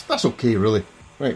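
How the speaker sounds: usual speaking voice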